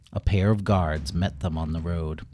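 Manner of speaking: usual speaking voice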